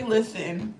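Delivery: ordinary talk, nothing marked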